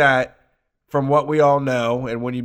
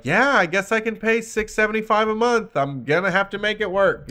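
resigned debtor; 8 semitones higher than usual. Retold or imitated.

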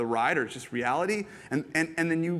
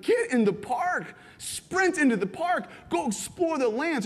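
mock screaming; 11 semitones higher than usual. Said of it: "we're all screaming".

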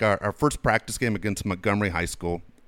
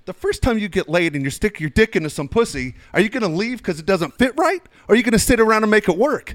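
put-on rising, higher, louder rant at the kids; mock coach rant; 10 semitones higher, 4 dB louder than usual. Coach scolding sulky kids over the pants, in a hammed-up rant. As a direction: hammer the team with a crude pep talk. Coach chewing out his team.

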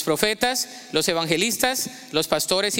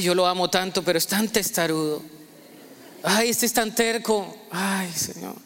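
lower, gentle fatherly voice fondly recognizing a son's cry; God as tender father; 3 semitones lower than usual. Acted. Preacher voicing God as a fond father naming his stubborn son, lower and tender. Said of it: "El Señor dice".

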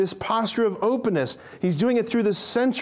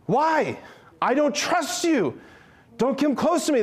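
fearful refusal; 8 semitones higher than usual. Retold or imitated. George fending Bert off in a hammed-up panic. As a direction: push him away, scared and defensive. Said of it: "he's like".

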